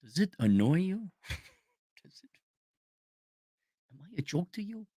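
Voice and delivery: hushed, lightly voiced touchy tough guy; cartoon gangster pigeon. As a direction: play a quietly menacing cartoon pigeon taking offense. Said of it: "The good feathers".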